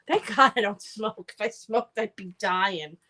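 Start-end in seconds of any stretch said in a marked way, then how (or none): none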